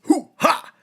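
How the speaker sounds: opening high loud yelp, then lightly voiced swagger; a hint of a cartoon he-man; Johnny Bravo. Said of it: "I'll just make him Johnny Bravo".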